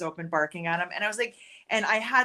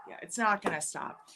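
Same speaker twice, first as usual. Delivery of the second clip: ordinary talk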